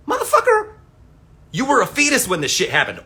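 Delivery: high, loud mock yell at the kids; mock outraged yell. Vlogger to young online fans, a mock furious yell. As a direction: blast them for pontificating.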